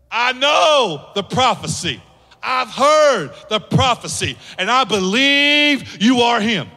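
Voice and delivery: slow, emphatic declaration of belief; calling out. Acted. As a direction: proclaim it, stretching the key words. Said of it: "But he goes".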